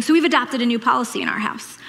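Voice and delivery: normal talking voice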